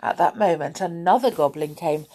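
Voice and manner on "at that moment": ordinary delivery, nothing marked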